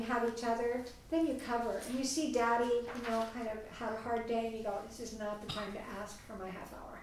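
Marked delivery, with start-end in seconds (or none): 4.9-7.0 whispered to oneself. Retold or imitated. Wife whispering a thought to herself as her tired husband arrives.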